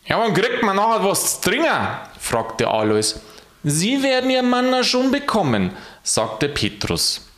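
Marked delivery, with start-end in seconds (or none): 0.1-1.7 high, quick indignant question; grumbling new angel. A narrator's reading. New angel demands a drink. 3.6-5.7 high, sustained singsong reply; Saint Peter. A narrator's reading. Peter answers the new angel.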